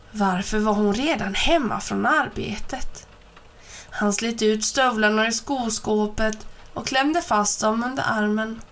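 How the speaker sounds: ordinary delivery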